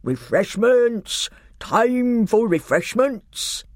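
high, slow, long-drawn hawker-like call. A narrator's reading. Fat old man on a donkey to the revellers, calling out. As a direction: stretch each word out as you call it high. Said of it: "began calling out at once".

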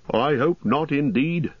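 high, emphatic landlord voice; lightly voiced country innkeeper; worried innkeeper. A narrator's reading.

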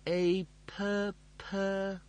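high, stuck stuttering syllables; robotic stammer. A narrator's reading. Mechanoid in a seizure loop, stammering. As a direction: jam on the syllable mid-word. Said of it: "cleared the seizure loop".

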